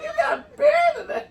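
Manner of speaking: high retold question through laughter; laughing imitation of a baffled reply; mock incredulous, laughing. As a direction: squeal the question in mock disbelief while laughing.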